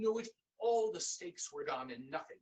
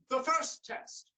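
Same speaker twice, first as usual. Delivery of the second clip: loud, higher and slow; stern king correction; 8 semitones higher, 6 dB louder, slower than usual. Acted. King corrects his daughter, loud and slow. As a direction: lay down the law to her.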